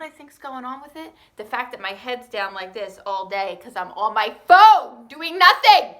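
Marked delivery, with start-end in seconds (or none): 4.5-6.0 suddenly loud and higher; playful yell at herself; mock outburst. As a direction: burst out in comic exasperation. Mock outburst about being idle.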